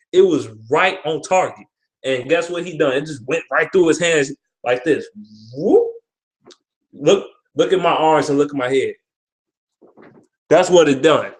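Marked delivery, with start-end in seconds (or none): 5.5-5.9 a quick, high whoop like the ball sliding past; whoop sound effect. Retold or imitated. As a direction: toss off a sudden high "whoop". Ball slips through his hands.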